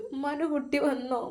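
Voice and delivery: soft, tender mother's greeting; loving mother. A narrator's reading. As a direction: gently call your son home. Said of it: "തുരുതുരെ ചുംബിച്ചു".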